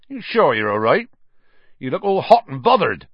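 big, gruff friendly giant; Hagrid voice. Acted. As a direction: speak as the kindly giant. Hagrid's voice in the story.

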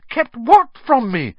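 put-on high, loud boyish question; urgent young curiosity; eager. A narrator's reading. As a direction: demand to know, keenly. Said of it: "said Harry eagerly".

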